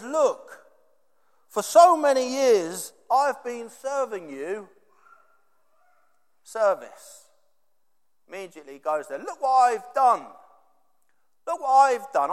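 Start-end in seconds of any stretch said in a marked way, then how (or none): 0.0-4.7 raised, slower aggrieved outburst; brother lets it out. Retold or imitated. Older brother vents to father, aggrieved. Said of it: "he lets it out". 9.2-12.3 higher, louder indignant insistence; aggrieved outburst. Retold or imitated. Older brother demands credit from father, indignant. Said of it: "he lets it out".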